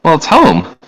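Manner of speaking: high-pitched matter-of-fact woman; woman's voice. Acted. Woman telling the visitor where he is, high woman's voice. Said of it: "a voice from a woman say".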